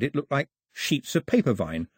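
ordinary delivery

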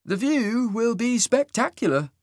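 high, slow, sing-song otherworldly voice; lilting and eerie, drawn out. A narrator's reading. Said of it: "Isaac's sing-song voice".

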